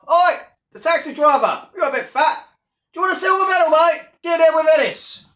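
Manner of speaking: high, brash mocking heckler voice. Mocking Paul taunting a taxi driver, high jeering voice. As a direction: jeer like a cocky loudmouth.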